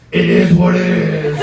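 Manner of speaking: slow mock raspy verdict; imitating friend's deep raspy voice. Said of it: "finishes with".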